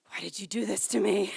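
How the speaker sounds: loud wounded dad whine; exasperated real dad. Retold or imitated. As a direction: play the fed-up dad, whining. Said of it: "in real life, dads are like".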